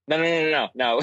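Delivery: amused, rapid-fire protest; real hasty denial. Real, not acted. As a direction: shoot the idea down fast. Hurriedly denying the sex-act joke.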